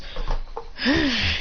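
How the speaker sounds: breath let out before speaking; heavy character exhale; acted sigh. Momoshiki appearing out of nowhere, acted sigh.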